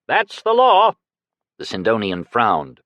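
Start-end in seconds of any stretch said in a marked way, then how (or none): 0.1-1.0 high, loud call from the crowd; shouting. A narrator's reading. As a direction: call it out sharply. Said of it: "Someone shouted".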